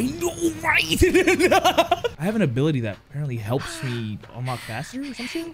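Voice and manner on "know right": high, drawn-out words into a laugh; laughing agreement. Real, not acted. Player agreeing with a friend's remark, high and laughing. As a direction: stretch it out, high, breaking into laughter.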